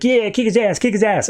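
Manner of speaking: high-pitched mock goading; egging on. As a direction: imitate a little crony cheering from behind. Small guy eggs on big guy.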